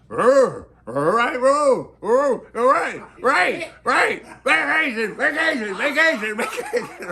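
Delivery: high, loud, giddy cartoon dog voice; hammed-up Scooby-Doo cheering about vacation; excited Scooby-Doo. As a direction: yelp it like an overjoyed Scooby-Doo. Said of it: "he's talking like Scooby-Doo".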